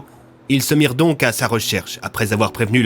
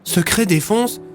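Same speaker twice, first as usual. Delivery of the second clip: high rising astonished question; surprised echo; 10 semitones higher, slower than usual. A narrator's reading. Rémy repeats the soldier's words, high and astonished. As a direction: echo it in disbelief, much higher. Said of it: "Rémy repeats, surprised".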